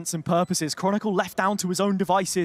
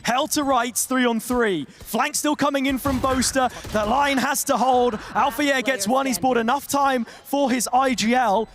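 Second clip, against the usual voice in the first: excited play-by-play; 5 semitones higher than usual. Acted. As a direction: call the fight with building urgency. Live play-by-play of a clutch round.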